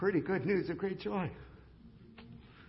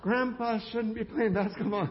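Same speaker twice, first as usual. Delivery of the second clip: loud, higher call across the gym; retold shout; 6 semitones higher, 7 dB louder than usual. Daughter calls out to grandpa on the court, shouting. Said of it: "Tammy shouts across the thing".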